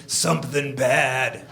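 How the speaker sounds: lightly voiced drunk, low and slow; drunk guy. Drunk stranger answering the friend about his pills, slow and low. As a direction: mumble it slowly, a touch low. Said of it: "And he goes".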